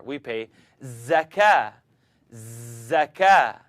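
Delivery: zakah slow with a buzzing z. Shown as a demonstration. Teacher models zakah's buzzing z. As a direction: give the z in zakah a clear hum. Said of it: "you hear the difference".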